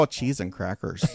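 low, rounded Minnesotan accent. Shown as a demonstration. Host showing how to say the mild curse, Minnesotan accent. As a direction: put on a folksy Minnesotan accent. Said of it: "do it with a Minnesotan accent".

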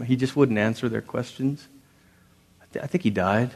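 a hint of an awkward fan admitting things; mild mock follower. Preacher voicing a follower, sheepish and quiet.

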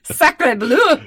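cartoon Frenchman cry; french chef. Mock French exclamation.

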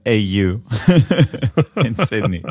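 put-on loud playful Aussie-accent announcement; Australian accent. Host announcing the Sydney conference, in a mock Australian accent. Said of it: "this was my best Australian accent".

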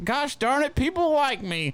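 mock a cheesy mirror affirmation; mocking self-affirmation. Teasing his friend's ego, in a high goofy self-affirmation voice. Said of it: "You look in the mirror every day saying".

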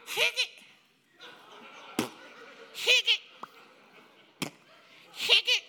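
high, loud imitated pellet gun shots; pellet rifle noise. Preacher mimics his pellet rifle against the crowd, high loud pops. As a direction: pop it out like a little rifle. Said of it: "It'd be like".

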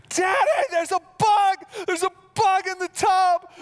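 put-on high, loud panicked shriek of a little girl; screaming daughter. Dad imitating his terrified daughter at bath time, shrill loud panic. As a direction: scream it like a kid terrified of a bug. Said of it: "breaking down and screaming".

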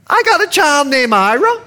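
very high Bahamian grandmother; Bahamian accent. Retold or imitated. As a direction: imitate a Bahamian granny questioning in a high voice. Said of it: "She's Bahamian, excuse the accent".

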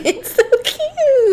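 high, slow laughing coo. Real, not acted. Host gushing over the caterpillar, high laughing coo. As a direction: gush over it.

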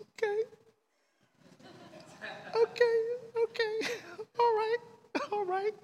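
tiny, very high squeak of agreement; hammed-up chastened little voice; meek surrender. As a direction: give in with small, high-pitched, halting replies. Acted meek surrender to God's answer.

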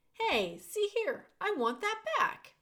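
put-on an old man grabbing back his only comfort; protesting prisoner. Prisoner protests as the rat steals his cloth, indignant.

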